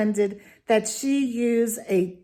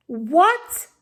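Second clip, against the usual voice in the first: What? rising higher in disbelief; a second, higher What? of disbelief; disbelieving What; 5 semitones higher than usual. Real, not acted. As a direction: ask What? again, more incredulous. Repeated in disbelief.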